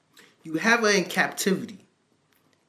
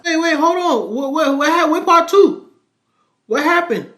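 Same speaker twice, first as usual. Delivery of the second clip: much higher, louder, incredulous outburst; high loud disbelief, demanding more; shocked interruption; 11 semitones higher, 7 dB louder than usual. Real, not acted. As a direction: cut in loud, can't believe it. Cohost interrupts, shocked by story.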